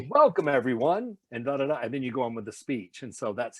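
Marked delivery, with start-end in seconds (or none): 0.1-1.8 louder announcer welcome. Shown as a demonstration. Park announcer opening the show, louder welcome. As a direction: open the show for the crowd.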